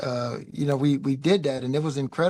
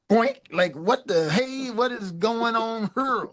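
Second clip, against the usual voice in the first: startled exclaim; 6 semitones higher, slower than usual. Retold or imitated. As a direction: mimic a boss caught off guard, drawn out. Prince startled at rehearsal.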